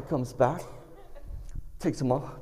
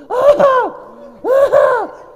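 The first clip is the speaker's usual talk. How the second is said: loud, girlish mock yelp of pain; high yelp; 13 dB louder than usual. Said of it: "I yelped".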